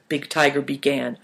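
ordinary delivery

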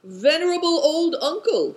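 high, loud respectful hail; calling out. A narrator's reading. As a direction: hail someone across a distance. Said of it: "he called out".

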